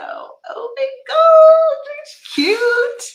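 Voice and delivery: real high, loud squeal of delight. Real, not acted. Gift opener gushing over a snowman, delighted squeal. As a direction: squeal it slowly, overcome by cuteness.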